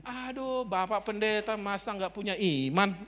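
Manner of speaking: high-pitched mock reproach; chiding landlord. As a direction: imitate someone chiding a pastor. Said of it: "Gitu dia ngomongnya".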